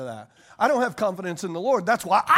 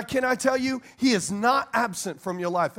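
usual speaking voice

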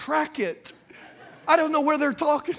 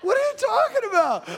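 high-pitched, baffled protest; retold outburst, voice jumping way up; incredulous retort; 9 semitones higher than usual. Husband answers wife's charge of meanness, high incredulous protest. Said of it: "I'm serious. I looked at her".

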